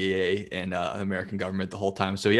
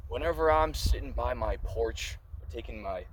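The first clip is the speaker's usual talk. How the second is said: mock ad read; 3 semitones higher, 3 dB quieter than usual. Fake sponsor beer ad read.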